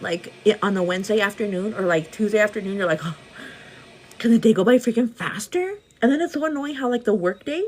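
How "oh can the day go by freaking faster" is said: mock impatience. Acted workday impatience.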